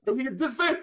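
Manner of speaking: high, animated demo voice. Shown as a demonstration. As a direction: act it out high and bright. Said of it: "Look at".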